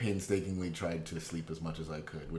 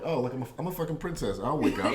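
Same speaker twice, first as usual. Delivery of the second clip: mock princess voice; 7 semitones higher, 4 dB louder, faster than usual.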